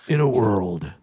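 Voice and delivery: low trailer-announcer voice; LaFontaine impression. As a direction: intone it like an epic trailer opener. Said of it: "did you know LaFontaine".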